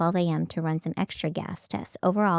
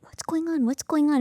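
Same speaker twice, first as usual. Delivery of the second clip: urgent high questioning, pitched way up; high anxious asking; 8 semitones higher than usual. Retold or imitated. Brittany demanding what the call is, high and anxious. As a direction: press him to say what's happening. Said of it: "And I'm like".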